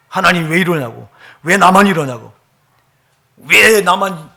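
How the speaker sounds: loud mock protest, building in force; acted complaint. Preacher replaying his old grievance against God, loudly complaining. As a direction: cry out an aggrieved "why me" to God.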